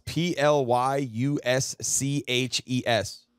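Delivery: letters spelled out one by one; spelling out letters. Shown as a demonstration. Streamer spelling a word to Alexa, letter by letter. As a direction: spell it slowly for the speaker. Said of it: "Alexa, pronounce".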